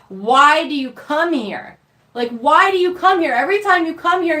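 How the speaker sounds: angry daughter demanding why mom keeps visiting; angry retort. Retold or imitated. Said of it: "I said to her".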